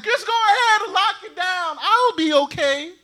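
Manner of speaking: sarcastic impression of a neighbor; mock hoarder. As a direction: parody the careless hoarder. Mocking selfish hoarder quote.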